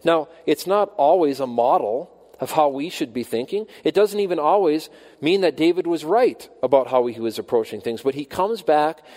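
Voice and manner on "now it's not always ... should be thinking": normal talking voice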